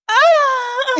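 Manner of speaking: high, loud mock cry; sudden high wail, hammed-up; imitated noise. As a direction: imitate an embarrassing cry blaring out. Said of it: "I hear".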